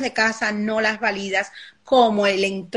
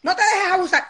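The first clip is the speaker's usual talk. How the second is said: much higher urging voice; pushy parent; 10 semitones higher than usual. Retold or imitated. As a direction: egg the child on to hit back. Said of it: "Qué estamos creando ahí".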